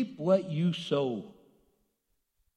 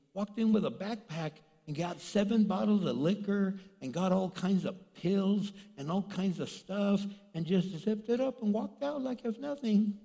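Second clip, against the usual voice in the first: normal talking voice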